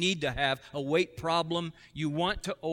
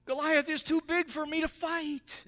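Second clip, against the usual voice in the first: high whiny frightened soldier; 5 dB louder than usual. Retold or imitated. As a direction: whine it like a scared soldier. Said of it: "the soldiers said".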